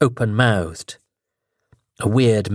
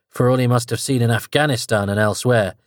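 ordinary delivery

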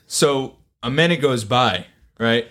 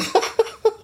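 short high burst of laughter; real laugh. Real, not acted. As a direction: crack up after the punchline. Laughs after the quote.

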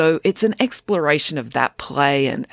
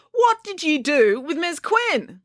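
high, slow, accusing question; accusing girl; 13 semitones higher, slower than usual. A narrator's reading. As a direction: lean on each word as the daughter. Accusing mother.

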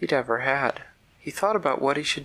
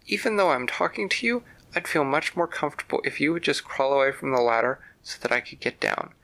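plain reading voice

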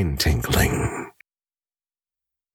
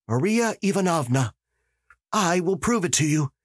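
put-on higher, insistent young voice; eager young detective, higher; 10 semitones higher than usual. Dukovsky begging the magistrate for permission, higher insistent voice. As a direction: demand to be believed, pushing hard.

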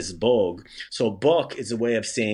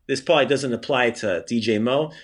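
normal talking voice

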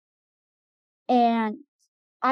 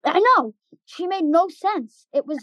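exasperated agreement; 6 semitones higher, 3 dB louder, slower than usual. Real, not acted. Agreeing, exasperated about teacher.

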